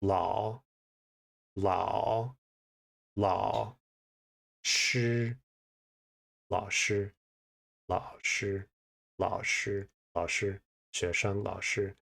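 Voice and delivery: 老 low dipping, 师 flat, 老师 repeated and softening; teacher's tone drill. Shown as a demonstration. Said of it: "a low tone followed by a, a flat tone".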